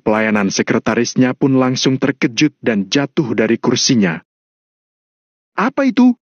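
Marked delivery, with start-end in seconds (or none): 5.6-6.2 high-pitched alarmed yell; startled shout. Acted. John's father hears a crash downstairs, startled shout. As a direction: cry out sharply at a sudden bang. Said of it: "teriak ayahnya John".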